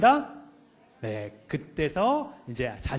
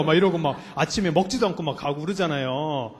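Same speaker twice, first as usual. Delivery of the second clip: ordinary talk, nothing marked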